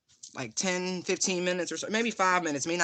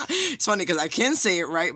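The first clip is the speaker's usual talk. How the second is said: laughing; 3 semitones higher, 5 dB louder than usual. Real, not acted. Laughing about the accent.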